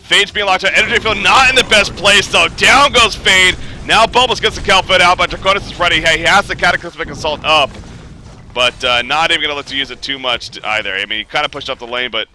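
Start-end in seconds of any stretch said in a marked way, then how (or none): 0.0-7.7 loud, fast-rising hype call; live play-by-play. Acted. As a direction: call the fight like an esports caster. Hyped play-by-play on a teamfight.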